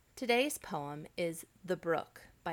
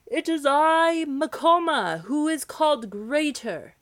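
high, loud heroic shout; 9 semitones higher, 14 dB louder than usual. Acted. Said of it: "shouted as loud as he could".

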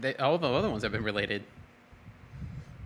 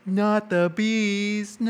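high, slow, anguished wail; panicked drawn-out screaming impression; Nicolas Cage Wicker Man scream; 10 semitones higher, 5 dB louder, much slower than usual. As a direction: wail it like a man covered in bees. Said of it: "I am Edward Mallis from The Wicker Man".

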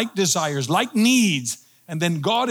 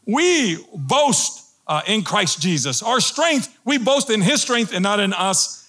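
fervent proclamation; preaching at full force; 4 semitones higher than usual. Acted. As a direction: declare it to the congregation. Pulpit proclamation, loud and high.

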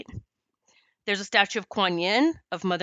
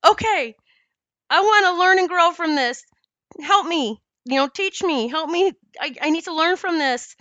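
raised, pleading, half-tearful retelling; mock-tearful plea, higher and louder; retold crying plea; 8 semitones higher, 5 dB louder than usual. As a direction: beg for help as if still in tears. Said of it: "and I'm crying".

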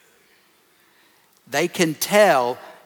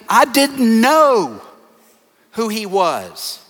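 drawn-out, high, loud plea of ignorance; acted plea to the judge, loud and slow; loud protest; 7 semitones higher, 8 dB louder, slower than usual. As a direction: protest it loudly, stretching out the words. Soldiers pleading before God in judgment.

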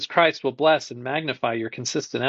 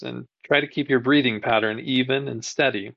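usual speaking voice

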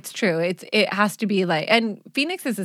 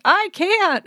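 much higher, louder, whiny sob; imitating crying child; 12 semitones higher, 4 dB louder than usual. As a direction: squeak it out like a weeping kid. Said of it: "And she's like".

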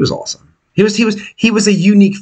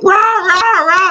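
mock screaming; 12 semitones higher, much slower than usual. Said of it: "was screaming at me".

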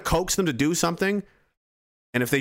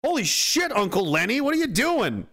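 startled shout; 9 semitones higher than usual. Retold or imitated. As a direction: catch your breath in shock and call out. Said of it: "You're like".

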